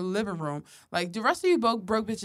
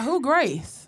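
nosy mom voice; 5 semitones higher, 7 dB louder than usual. Retold or imitated. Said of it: "She be like".

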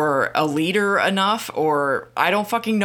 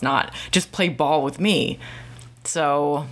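normal talking voice

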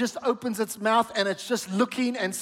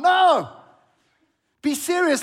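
loud, higher, insistent exhortation; emphatic no; 7 semitones higher, 6 dB louder than usual. Real, not acted. Pastor urging his church to get serious, loud emphatic call. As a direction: cut them off and demand seriousness.

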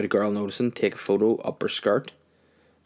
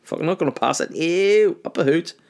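high cheeky mock agreement; mocking old MP; 8 semitones higher than usual. Podcaster mimicking an MP who agrees yet blocks the bill, higher and cheeky. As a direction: play the smug contrarian. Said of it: "This guy's like".

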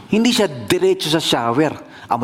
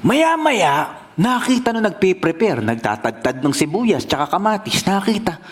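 ordinary talk, nothing marked

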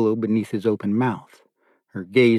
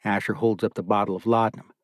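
ordinary delivery, nothing marked

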